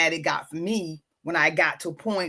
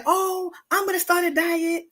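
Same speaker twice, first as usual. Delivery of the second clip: imitating a cheerful self-promise, higher; high, chirpy self-talk about dieting; playfully mimicking the dieter's promise; mock resolution; 8 semitones higher than usual. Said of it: "you say".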